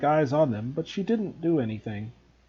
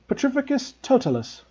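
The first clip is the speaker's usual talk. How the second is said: crying out a spell; 9 semitones higher than usual. A narrator's reading. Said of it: "she cried".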